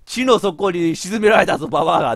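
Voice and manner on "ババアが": usual speaking voice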